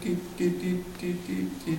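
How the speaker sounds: soft, high little doots; quiet mimed dialing noise; imitated phone dialing.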